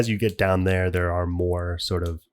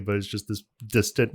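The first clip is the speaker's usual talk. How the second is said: normal talking voice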